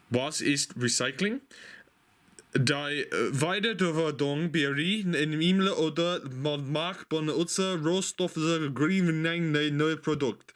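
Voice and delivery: trying out German from a notebook cover, half-joking; mock German reading. Reading German off a stationery cover, mock-serious. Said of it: "I'm not gonna carry on doing that".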